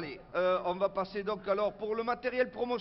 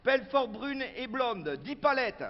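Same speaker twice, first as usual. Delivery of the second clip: slow, higher, emphatic item reading; meeting budget announcement; 6 semitones higher, 5 dB louder, slower than usual. Acted. Local official reading the drinks budget to activists, slow proud announcing. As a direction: proclaim each order like a grand policy.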